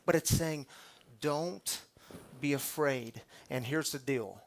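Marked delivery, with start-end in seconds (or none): 1.2-3.2 still small voice. Acted. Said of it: "still small voice".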